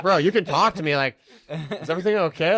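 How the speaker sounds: high, loud, over-concerned pleading; checking in like a wife. Retold or imitated. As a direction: plead with your buddy like a worried spouse, hammed up. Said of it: "checking in on him like I'm his wife".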